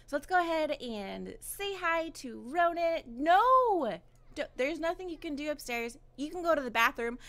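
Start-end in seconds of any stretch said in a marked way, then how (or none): 3.1-4.0 loud drawn-out protest; exasperated cry. Real, not acted. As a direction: call out a long, loud no. Scolds a game character.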